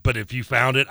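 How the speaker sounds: ordinary talk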